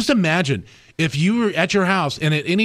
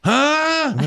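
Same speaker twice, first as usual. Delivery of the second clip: confused dad imitation; 10 semitones higher than usual. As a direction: pitch the grunt way up, puzzled. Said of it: "And she goes".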